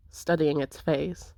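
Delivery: ordinary talk, nothing marked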